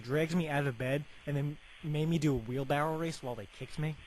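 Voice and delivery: ordinary talk, nothing marked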